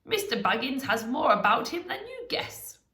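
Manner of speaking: lightly voiced delight, rising at the end; amused wizard. A narrator's reading. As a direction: crow it as an acted wizard.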